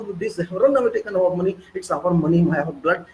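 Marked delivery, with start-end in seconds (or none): none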